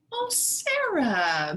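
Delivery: hammed-up delighted greeting; gushing. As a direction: pour on the sweetness like an eager principal. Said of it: "Missus Burton gushed".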